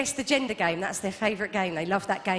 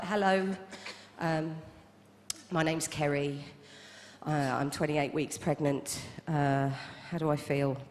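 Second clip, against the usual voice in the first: deadpan; 8 semitones lower, 6 dB quieter than usual. Retold or imitated. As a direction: mumble it with a straight face. Her own deadpan yoga answer.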